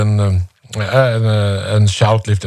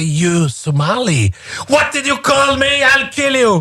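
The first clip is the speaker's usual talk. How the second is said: much higher mock rage, hammed-up; mock furious reply; 13 semitones higher than usual. Insulted guy answering the Norwegian, hammed-up mock rage. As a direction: play an exploding threat for laughs.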